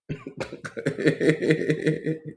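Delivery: long high giggle; amused laugh. Real, not acted. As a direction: laugh at your own imitation, high. Laughs at own imitation.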